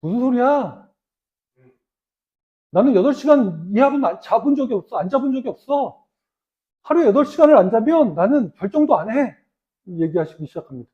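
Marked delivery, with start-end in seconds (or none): 0.0-9.3 a hint of a brusque old boss, louder; lightly voiced gruff chairman; blunt chairman. Preacher retelling the chairman's retort to the interviewer, lightly acted. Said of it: "정주영 회장님이 그랬대잖아요".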